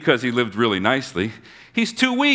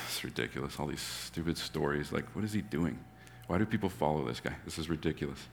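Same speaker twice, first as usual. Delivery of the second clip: much softer, lower dismissive grumble; scoffing mutter; 6 semitones lower, 15 dB quieter than usual. Retold or imitated. Pharisees scoffing at Jesus under their breath. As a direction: mutter it like a sneering skeptic.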